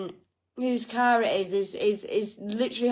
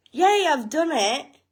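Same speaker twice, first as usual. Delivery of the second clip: brighter, louder little cheer of victory; a hammed-up yay of relief; mock triumphant cheer; 5 semitones higher, 5 dB louder than usual. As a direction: celebrate like you've already won. Retold morning triumph.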